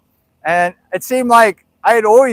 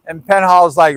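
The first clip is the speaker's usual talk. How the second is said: ordinary delivery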